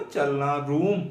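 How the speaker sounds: normal talking voice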